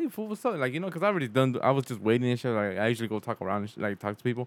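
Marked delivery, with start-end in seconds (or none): 0.0-0.6 high, soft hello; mock friendly hello. Walking toward his friend, high greeting. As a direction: call out gently and high.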